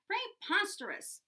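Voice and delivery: high, scornful disbelief; indignant teacher scoffing. A narrator's reading. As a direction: dismiss the excuse with a scoff.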